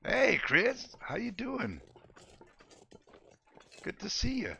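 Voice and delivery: high, bright hello; excited greeting. Real, not acted. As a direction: greet a friend with a big lift.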